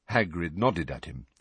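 usual speaking voice

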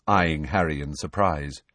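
usual speaking voice

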